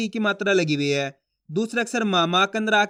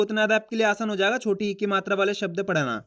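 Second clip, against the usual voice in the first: ordinary talk, nothing marked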